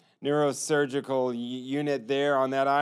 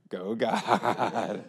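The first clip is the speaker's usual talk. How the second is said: laughing praise; 5 semitones lower than usual. Real, not acted. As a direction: chuckle of wonder. Laughing at the miracle.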